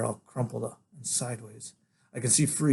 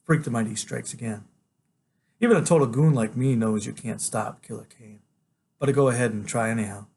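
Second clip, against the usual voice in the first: plain reading voice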